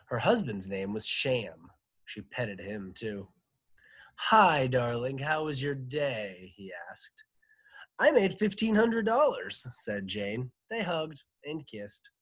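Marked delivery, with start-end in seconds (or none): 4.1-6.5 lightly voiced husband greeting his wife, loud opening; hint of a doting husband, slowing at the end; husband's greeting. A narrator's reading. 8.0-9.6 high, loud boast; wife crowing to her husband; boastful wife. A narrator's reading.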